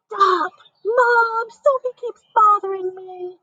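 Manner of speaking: put-on high, whiny kid's yell; yelling child. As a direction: holler at mom like a bothered kid. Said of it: "Schubert yelled".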